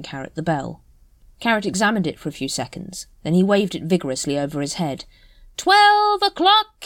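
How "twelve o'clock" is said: high, loud, slow town crier call; bellowing. A narrator's reading. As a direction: bellow it across the street. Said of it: "he bellowed".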